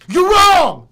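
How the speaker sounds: very high, full-volume yell; shouting. Real, not acted. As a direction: yell it as a final verdict. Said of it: "sorry for making you shout".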